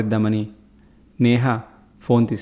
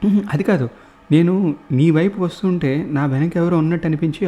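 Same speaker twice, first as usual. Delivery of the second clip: startled exchange; 5 semitones higher than usual. A narrator's reading. Neha and watchman dialogue.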